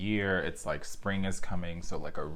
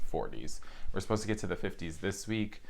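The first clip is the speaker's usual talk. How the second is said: ordinary talk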